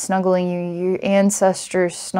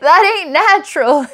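hillbilly line repeated through laughter, higher; laughing hillbilly; 9 semitones higher than usual. Retold or imitated. Hillbilly warning the listener, laughing through the hillbilly accent. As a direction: crack up while drawling it in mock hillbilly. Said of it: "I gotta tell it to you like that".

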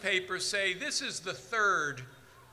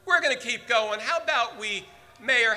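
usual speaking voice

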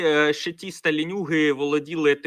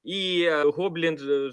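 ordinary talk

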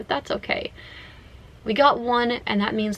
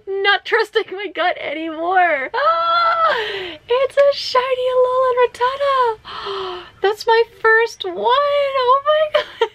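very high, laughing, near-tearful squealing; ecstatic shock; 13 semitones higher, 3 dB louder, slower than usual. Real, not acted. Player finding her first shiny Rattata, ecstatic shock. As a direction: gasp and squeal in overwhelmed disbelief.

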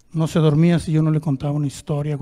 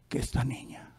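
hushed whisper; 4 semitones lower, 13 dB quieter than usual. Acted. Preacher hushes the doctor's words to a whisper. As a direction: drop to a whisper for the reveal.

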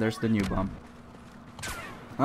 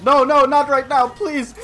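panicked pleading; 13 semitones higher, 8 dB louder than usual. Real, not acted. Panic in game.

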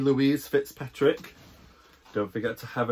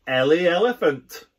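squealing; 6 semitones higher, 4 dB louder than usual. Acted. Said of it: "Jody squeals".

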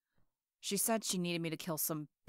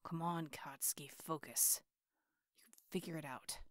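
whispered pep talk; 3 semitones lower, 9 dB quieter than usual. Acted.

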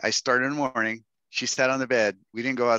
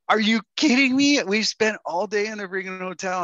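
high, loud disbelief at him; exasperated wife; 11 semitones higher, 4 dB louder than usual. Retold or imitated. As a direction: let her blow up in disbelief. Wife exasperated after day indoors.

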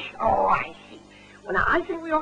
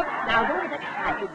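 usual speaking voice

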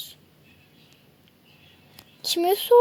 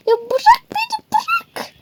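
a tiny bear squealing to be let go; squeaky captive cry. Acted. Captured bear struggles again.

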